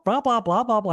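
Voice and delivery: high-pitched stand-in chatter; glib babble. Retold or imitated. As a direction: rattle off an imagined ready answer. Imagines having a ready answer.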